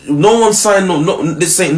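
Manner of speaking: ordinary delivery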